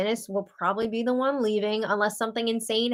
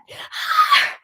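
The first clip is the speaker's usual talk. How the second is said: sigh. Real, not acted. Reacting to Dennis's heartbreak.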